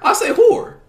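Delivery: "horror" as high, one-syllable "hore"; swallowed word ending. Real, not acted. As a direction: insist on "horror" with no second syllable. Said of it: "enunciate the last part of the word".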